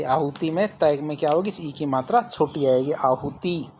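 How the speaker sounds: ordinary talk, nothing marked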